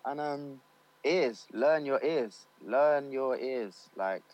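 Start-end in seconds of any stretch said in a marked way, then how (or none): 1.1-3.9 slow emphatic. Real, not acted. Stressing key advice slowly.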